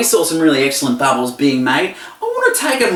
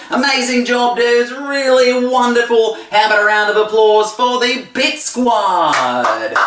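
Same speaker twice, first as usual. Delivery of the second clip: rally the crowd for applause; rousing cheer; 6 semitones higher than usual. Acted. Host asking a round of applause for the squad, excited.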